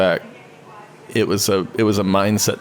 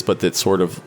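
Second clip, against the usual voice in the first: ordinary talk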